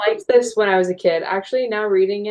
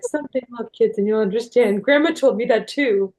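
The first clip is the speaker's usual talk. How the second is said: lightly imitated tearful parent; a touch choked up, acting out a weepy mom; mock weepy; 4 semitones higher than usual. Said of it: "like, a weepy weirdo".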